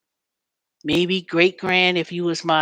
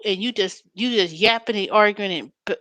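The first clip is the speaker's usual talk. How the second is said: normal talking voice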